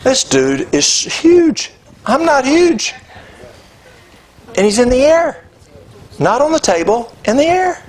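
amazed. Real, not acted. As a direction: pitch way up, reliving the shock. Amazed retelling of lifted arm.

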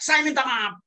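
mock-angry apology, barked out loud; snapped apology. Shown as a demonstration. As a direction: snap the apology at them like a rebuke. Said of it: "intonasi permohonan maaf kita itu dengan membentak".

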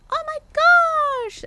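high, loud squealed gasp; excited squeal. Shown as a demonstration. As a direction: squeal it like you're thrilled. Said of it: "do you mean when I'm excited".

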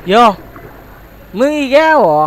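high, loud odd voice; annoyed character voice. Acted. Creature annoyed the human is back again, loud odd voice. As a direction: sneer at the returning pest.

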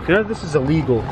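ordinary delivery

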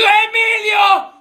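high, drawn-out yell of the name; calling out shout. Acted. Classmate returning and calling to Emilio, drawn-out shout.

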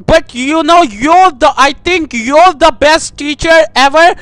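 ordinary delivery

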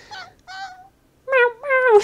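high mewing meow; squeaky copycat meow; imitating the game cat. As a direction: mew it back like the little Sim animal. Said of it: "That's literally what it just did".

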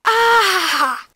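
sharp yelp of pain; pained cry. Real, not acted. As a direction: cry out from a stubbed toe. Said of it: "There's my little toe".

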